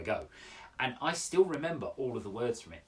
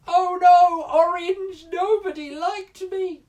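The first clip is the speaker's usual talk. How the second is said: high, loud mock wail; hammed-up heartbroken whine, very high; 12 dB louder, slower than usual. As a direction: wail theatrically like a rejected child. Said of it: "I don't think [name] goes home at the end of the day going".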